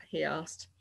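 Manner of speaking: ordinary delivery, nothing marked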